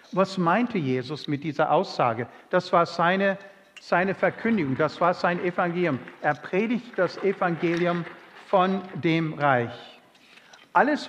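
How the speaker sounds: laut und eindringlich gepredigt; kraftvolle Predigt. Acted. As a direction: mit Nachdruck zur Gemeinde predigen. Predigt mit voller Kraft. (laute, eindringliche Predigtstimme)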